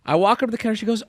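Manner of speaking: normal talking voice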